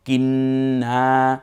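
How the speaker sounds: কিন্না with the n held long, then long a; doubled nasal n. Shown as a demonstration. Teacher joining the letters, slow কিন্না with held n. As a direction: hum on the n of কিন্না, then stretch the ending. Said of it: "নুনটার উপরে আমার তাসদিদ ব্যবহার হবে".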